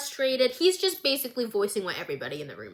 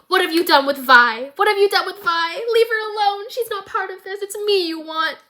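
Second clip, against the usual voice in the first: desperate shout; 8 semitones higher, 5 dB louder than usual. Retold or imitated. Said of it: "And Caitlin goes".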